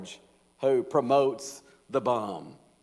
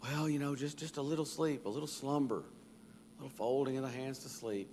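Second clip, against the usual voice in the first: softer, drowsy sleepyhead begging; lazy man; 2 semitones higher, 10 dB quieter than usual. Acted. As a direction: mumble it like you can't open your eyes. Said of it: "the response of the lazy man".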